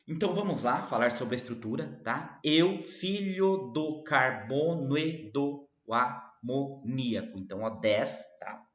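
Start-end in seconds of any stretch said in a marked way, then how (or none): 2.4-7.4 lento, sílaba por sílaba; escansão silábica. Shown as a demonstration. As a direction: separe cada sílaba poética, devagar. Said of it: "são dez sílabas poéticas". (escandindo o verso pausadamente)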